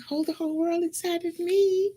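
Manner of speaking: high, bewildered echo of the question; puzzled girl. Retold or imitated. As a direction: repeat it back, baffled and high-pitched. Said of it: "And she was like".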